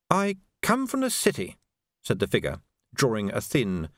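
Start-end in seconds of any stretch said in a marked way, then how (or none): 0.1-1.6 higher, measured assassin's voice. A narrator's reading. Assassin answers his terrified victim, higher and measured. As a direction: answer as the hooded killer.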